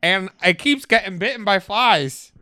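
hammed-up Minnesotan plea; Minnesota accent attempt. Farmer with a fly-bitten zebra, Minnesota accent. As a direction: go all in on a shaky Minnesota accent. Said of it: "I'm gonna go for it".

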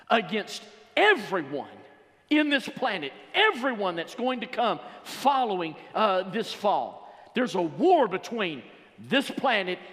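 loud, high, slowed declaration building to a shout; preaching at full force. Acted. God declaring war on the devil, preached at full force. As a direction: proclaim war, rising to a peak.

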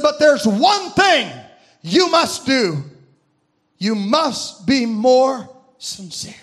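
very slow, weighted, sinking at the end; solemn charge. Retold or imitated. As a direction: imitate a heavenly charge, word by measured word. Voice from above gives solemn charge.

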